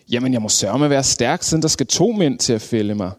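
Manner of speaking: plain reading voice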